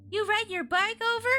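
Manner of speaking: put-on high-pitched girl's question; Chris. A narrator's reading. Chris asking her friend, high girlish voice. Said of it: "Chris asked".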